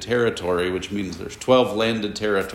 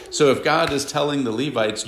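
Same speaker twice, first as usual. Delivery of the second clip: ordinary talk, nothing marked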